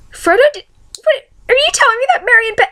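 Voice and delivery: high, fast, stammering shock; squeaky mock-outrage, tripping over words; acted disbelief. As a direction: re-enact your appalled reaction at a high pitch. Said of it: "staring at my computer screen like".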